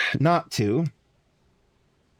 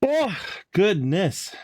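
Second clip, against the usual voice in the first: slow words stretched by a yawn; 7 semitones higher, much slower than usual. Real, not acted. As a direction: say it mid-yawn, drawn out. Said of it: "I don't know why I'm yawning so much".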